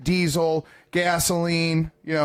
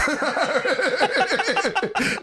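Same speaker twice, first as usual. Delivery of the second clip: long high-pitched laugh; cracking up at the punchline; high burst of laughter; 11 semitones higher than usual. Real, not acted. Laughing at own story.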